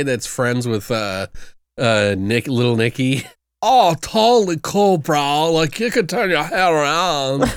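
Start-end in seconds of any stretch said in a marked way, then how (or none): 3.6-7.5 surfer dude impression. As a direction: play a dopey stoner bro friend. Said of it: "you know what you kinda sound like?".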